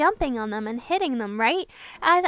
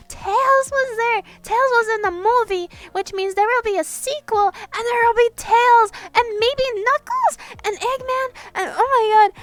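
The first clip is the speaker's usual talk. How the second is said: high, loud gushing; excited fangirling; 8 semitones higher, 5 dB louder than usual. Real, not acted. Fan telling viewers about the sequel, bubbling with excitement. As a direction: gush like a thrilled fan. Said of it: "way too excited about this".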